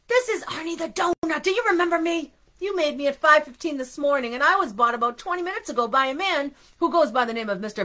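a touch of panic, pouring out the story; frantic. A narrator's reading. Frantic donut pleads with baker by phone.

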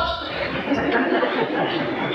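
breathy unvoiced chuckle; amused laugh. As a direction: laugh it off under your breath. Laughter after the ten pound.

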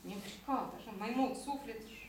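low-key mumbling to oneself. Acted. Shepherd rehearsing his wolf part, mumbling to himself. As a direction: mumble it softly, phrase by phrase. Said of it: "Ion bolmojând".

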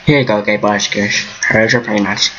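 usual speaking voice

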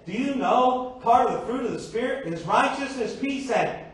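fervent preacher, voice climbing; preaching at full force. Real, not acted.

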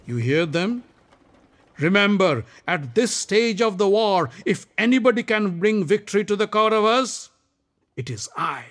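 proud Karna. Acted. Karna boasting to Shalya, proud and loud. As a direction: claim the glory.

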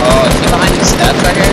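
fast, loud and high; frantic. Real, not acted. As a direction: rush it out in alarm. Searching enemy frantic.

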